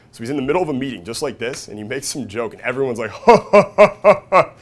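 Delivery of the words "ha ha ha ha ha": loud, high-pitched fake guffaws; mock cracking up. Comic imitating a meeting cracking up at a joke, mock loud laughter. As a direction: imitate a room roaring with laughter. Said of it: "like, cracking up".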